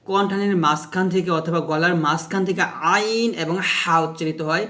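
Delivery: ordinary talk, nothing marked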